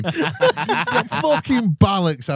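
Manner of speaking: usual speaking voice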